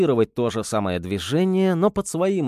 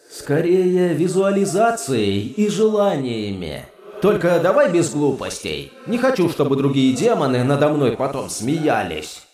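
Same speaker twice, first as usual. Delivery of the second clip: demon voice; 2 semitones higher than usual. Acted. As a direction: speak as the demon inside his head. Demon brother speaks.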